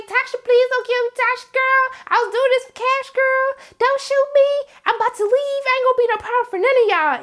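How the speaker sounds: high, pleading put-on voice of a woman begging; hammed-up desperate plea; pleading Keisha. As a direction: beg Tasha not to shoot, in a high voice. Keisha begging for her life.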